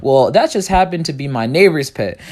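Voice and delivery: ordinary talk, nothing marked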